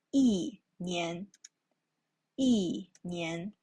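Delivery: 一年 with 一 falling as yì before nián; teacher demonstrating 一年 tone change; fourth tone yi. Shown as a demonstration. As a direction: model 一年 as yìnián, 一 dropping. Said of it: "年 is a second time character".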